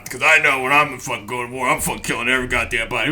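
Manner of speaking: deep, low drill-instructor voice; Staff Sergeant DeBell. Retold or imitated. Drill instructor boasting to recruits about war, in a deep imitated voice. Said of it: "drill instructor named Staff Sergeant DeBell".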